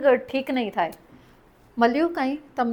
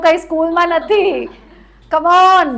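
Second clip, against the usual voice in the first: loud, raised-pitch urging; rallying call; 4 semitones higher, 5 dB louder, slower than usual. Real, not acted. Coach urging group to shout louder. (જોરથી પાનો ચડાવતાં)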